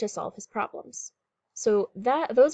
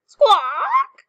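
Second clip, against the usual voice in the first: high, loud parrot cry; storybook parrot's piercing squawk; 10 dB louder than usual. A narrator's reading. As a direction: screech it like a startled parrot. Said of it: "cries the parrot".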